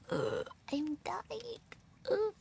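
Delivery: slow, high, drained moan; hammed-up, drawn-out complaint of exhaustion; mock exhausted groan. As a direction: groan as if wilting from heat. Retold exhaustion walking in heat.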